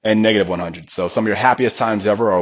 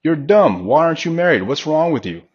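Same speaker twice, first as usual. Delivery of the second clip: higher, louder scolding grandparent voice; nagging grandparent; 7 semitones higher, 3 dB louder than usual. Retold or imitated. Grandparents scolding an unmarried grandchild, higher nagging voice. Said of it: "So grandparents tell you right now".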